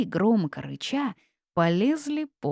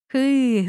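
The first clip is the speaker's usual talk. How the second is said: high, loud wheezed "hy"; groan of laughter; 7 semitones higher, 7 dB louder than usual. A narrator's reading. Witch rolls over, weeping with laughter, moaning. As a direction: moan helplessly from laughing. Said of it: "стонала я".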